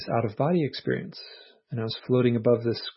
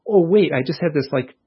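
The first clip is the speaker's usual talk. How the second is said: giddy realization; 5 semitones higher, 7 dB louder than usual. Retold or imitated. At the coffee shop, suddenly realizing the crazy experience, laughing louder and higher. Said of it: "And I was like".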